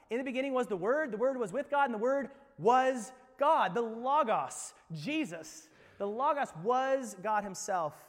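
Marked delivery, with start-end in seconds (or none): 0.1-3.7 loud, rising scripture read; preached scripture. Acted. Pastor proclaiming John one to the congregation, loud. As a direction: proclaim the verse to the hall.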